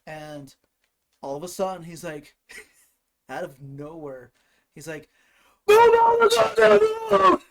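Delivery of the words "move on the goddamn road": full-blast, high furious scream; yelling in road rage. Retold or imitated. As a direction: scream at the driver ahead, dragging it out. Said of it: "getting stressed out or yelling at people".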